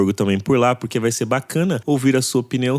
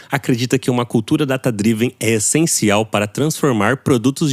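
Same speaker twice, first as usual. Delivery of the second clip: energetic, emphatic podcast ad read; 4 semitones higher than usual. Acted.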